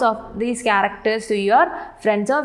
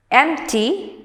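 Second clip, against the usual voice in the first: "Empty" with the weight on EMP; stress on first syllable; 3 semitones higher than usual. Shown as a demonstration. Teacher reading words to students, "Empty" stressed on EMP. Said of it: "stress on the first syllable are empty".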